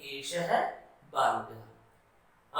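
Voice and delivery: बालकः stretched long, dropping in pitch; drawn-out Sanskrit demo. Shown as a demonstration. Teacher dragging out the answer for the class. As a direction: draw बालकः out slowly. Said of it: "इसके लिए क्या बोलेंगे".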